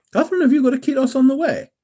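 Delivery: high, fast, eager question to a viewer; excited call-out. Real, not acted. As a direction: call out to Catherine, thrilled. Calling out to viewer Catherine.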